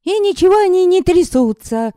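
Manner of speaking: put-on high, loud indignant girl. Yaska protesting to the mocking men, high and indignant. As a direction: protest hotly. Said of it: "Возмутилась она".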